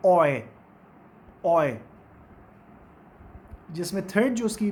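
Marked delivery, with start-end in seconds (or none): 0.1-1.8 ओए said low and firm, aw gliding to ee; teacher modelling the oy sound; diphthong demo. Shown as a demonstration. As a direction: slide ओए from aw to ee. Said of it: "वो है".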